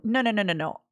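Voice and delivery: usual speaking voice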